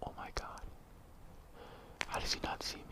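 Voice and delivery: terrified whisper; panicking gamer. Real, not acted. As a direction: whisper so he can't hear. Hiding as gunman passes.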